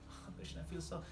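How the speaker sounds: hushed, breathy plea to Krishna; whispered prayer. Shown as a demonstration. Preacher whispering a remorseful prayer to Krishna. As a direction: whisper it like a private confession.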